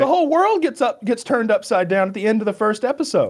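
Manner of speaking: high, emphatic rush of enthusiasm; real excitement about a big twist; excited explanation. Real, not acted. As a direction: start way up high and ease down slightly. Excited answer continues.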